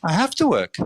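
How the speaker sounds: high-pitched imitation of a protesting worker; indignant immigrant worker. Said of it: "they would go".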